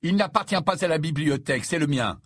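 mildly heated protest. A narrator's reading. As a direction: protest in your own defence. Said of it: "protesta Harry".

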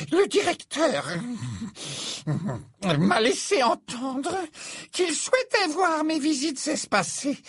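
very high, cold and haughty; a wounded teacher freezing him out; cold seer. Acted. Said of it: "répliqua-t-elle avec froideur".

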